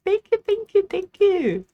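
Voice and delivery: high, loud sugary thank-you; chirpy thanks. Real, not acted. Cashier thanking a customer, high and chirpy.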